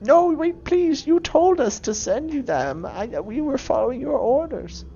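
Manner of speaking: high, urgent begging; frightened worshipper, pleading. Acted. Worshipper threatened with sacrifice, frightened pleading.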